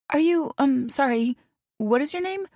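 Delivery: put-on high, hesitant young woman; flustered young woman. A narrator's reading. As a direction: ask it nervously, stumbling. McKinley flustered question.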